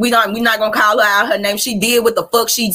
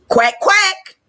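mock duck quack; 9 semitones higher than usual. Mocking duck after "a duck a duck.

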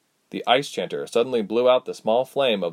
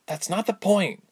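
high, mildly terse retort; understated irritation; quiet snap; 11 semitones higher than usual. A narrator's reading. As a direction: cut her off with a restrained snap. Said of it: "Ilse snapped quietly".

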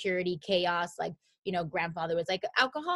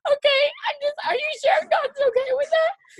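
very high, louder worried whimper; anxious high self; 8 dB louder than usual. Retold or imitated. She asks her mom nervously, in a very high whimper. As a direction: whimper it like a scared kid. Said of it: "I'd be like".

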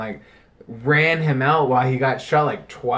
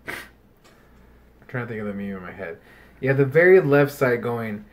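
ordinary talk, nothing marked

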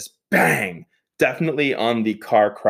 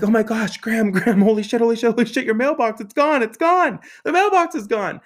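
high-pitched frantic alarm, climbing as it goes; hammed-up panic, words tumbling out in repeats; re-enacted panic; 12 semitones higher, 3 dB louder than usual. Telling Graham her mailbox is gone, mock panic.

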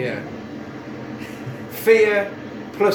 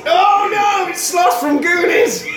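laughing shout; 13 semitones higher, 5 dB louder than usual. Real, not acted.

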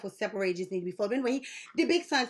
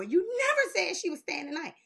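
usual speaking voice

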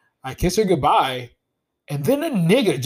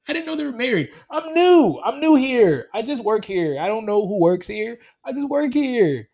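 high, insistent excuses; mock innocent protest; 7 semitones higher, 5 dB louder than usual. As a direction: plead ignorance like the clueless new hire.

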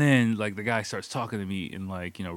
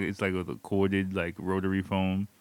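normal talking voice

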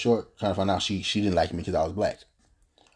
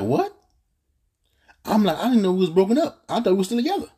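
high, louder stunned disbelief; retelling his shocked reaction to her, pitched way up; retold shock; 12 semitones higher, 5 dB louder than usual. Boyfriend retells learning they broke up, high and loud. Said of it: "So I'm like".

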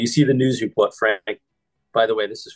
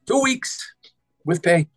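higher mock delivery of "Two weeks with pay"; mimicking the boss; 10 semitones higher, slower than usual. Podcast host mimics the boss saying two weeks with pay. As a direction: savour "Two weeks" up high. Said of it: "the way he pronounces".

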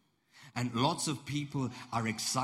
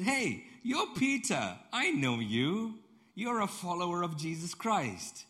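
high, slow call-out; raised-voice acted recognition, stretched words; loud accusation; 9 semitones higher, 4 dB louder, slower than usual. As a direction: point someone out loudly for all to hear. Acted accuser recognizing Peter at campfire.

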